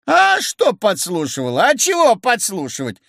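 high, protesting questions; defiant retort. A narrator's reading. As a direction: throw the accusation back. Accused woman retorts in quarrel.

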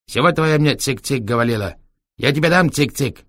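put-on high, threatening Chinese-accented scolding; angry Chinese accent. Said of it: "с китайским акцентом". (сердито, с китайским акцентом)

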